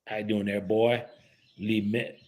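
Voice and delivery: badly faked Southern accent; Southern accent attempt. Caller testing a Southern voicemail greeting, tentative Southern accent. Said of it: "I can't even do a Southern accent".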